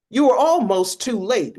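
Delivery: sharp, loud and high, scolding; urgent reproach. Acted. Lawyer scolding the federal agent, loud and sharp. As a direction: snap at him that he nearly came too late.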